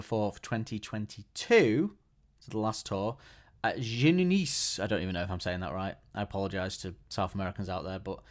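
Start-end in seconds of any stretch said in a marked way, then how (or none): 3.6-4.8 put-on French accent. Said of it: "saying it in a French accent".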